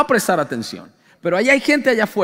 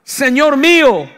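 high, loud cry of alarm; anguished cry; 4 semitones higher, 6 dB louder, much slower than usual. Acted. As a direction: cry out to the Lord in dismay. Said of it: "Y gritó diciendo".

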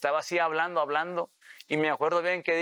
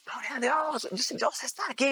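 imitated shouting; 10 semitones higher than usual. Man retelling how the beach Christians began shouting, imitated high cries. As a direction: cry out like excited believers, pitched way up. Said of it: "empezaron a gritar".

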